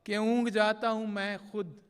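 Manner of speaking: measured, projected mushaira verse with drawn-out line endings; poetry recitation. Acted. Poet reciting love couplets to a mushaira audience, declaimed Urdu verse. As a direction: recite the ghazal line by line, pausing between phrases.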